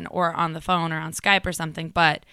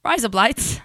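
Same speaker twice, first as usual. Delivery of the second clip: mock Australian pronunciation; Australian accent; 3 semitones higher, slower than usual. Shown as a demonstration. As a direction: show off a jokey Aussie accent. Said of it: "It's razor blades in Australian".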